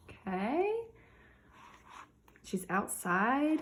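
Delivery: ordinary talk, nothing marked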